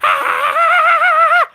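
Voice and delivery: very high, squealing toad cackle; high put-on toad laugh. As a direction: laugh like a manic cartoon toad. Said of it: "This is me laughing in the toad voice".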